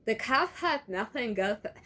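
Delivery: stiff, tight-lipped attempt at a girl with new braces. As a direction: talk with lips pressed against the teeth. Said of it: "her lips tight against her teeth".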